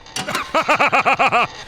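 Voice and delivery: squeaky high giggle; high cartoon cackle. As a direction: laugh at your own joke, cartoonish. Character laughs at own joke.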